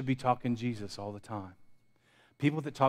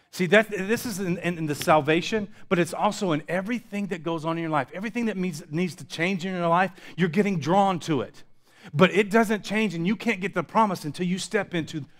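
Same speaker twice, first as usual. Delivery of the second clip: loud, high, driving sermon climax; full-force preaching; 7 semitones higher, 7 dB louder than usual. Acted. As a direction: preach it out, rising in fervour.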